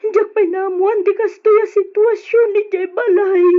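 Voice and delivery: weeping over the situation at home; crying. Acted. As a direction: sob out the despair.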